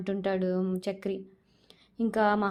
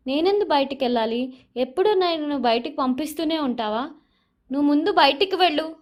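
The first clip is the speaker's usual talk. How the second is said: retold shout; 5 semitones higher, 4 dB louder than usual. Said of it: "Mahalakshmi అరుస్తూనే ఉంటుంది".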